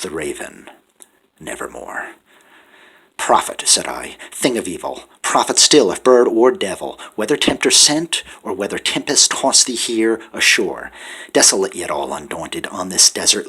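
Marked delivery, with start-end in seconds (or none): none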